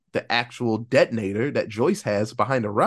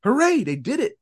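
a much higher ironic hooray; mock cheer; 9 semitones higher, 3 dB louder than usual. The bridge explodes, higher mock cheer. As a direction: cheer it with fake glee.

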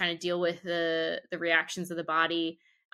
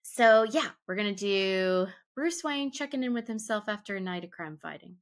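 normal talking voice

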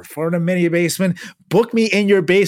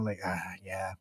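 whisper; 10 semitones lower, 19 dB quieter than usual. Real, not acted. Trails to a whisper.

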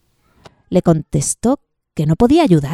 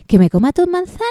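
louder mocking rhetorical ask; rhetorical question; 6 semitones higher, 6 dB louder, much faster than usual. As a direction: scoff at it. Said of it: "preguntó retóricamente".

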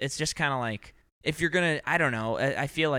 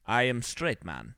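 slow mock Russian accent; slower than usual. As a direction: echo it slowly in clichéd Russian English. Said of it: "he would say".

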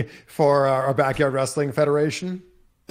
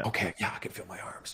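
barely voiced whisper; whispered self-check; 5 semitones lower, 12 dB quieter, faster than usual. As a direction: breathe it out to yourself. Checking himself after the fall.

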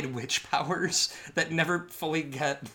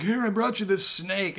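mock snake guy; 7 semitones higher, 4 dB louder than usual. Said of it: "is like".

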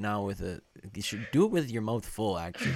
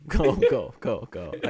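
louder, hurried urging; excited go go; 3 semitones higher, 9 dB louder than usual. Real, not acted. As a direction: rush them to go on. Urging him to speak.

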